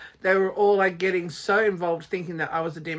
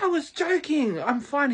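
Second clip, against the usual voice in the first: retold protest; 8 semitones higher than usual. Retold plea to captors.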